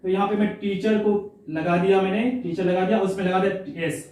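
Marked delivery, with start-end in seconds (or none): none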